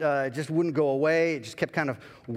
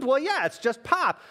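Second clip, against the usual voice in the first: defensive; 11 semitones higher, 4 dB louder than usual. Retold or imitated. Pastor insisting to God.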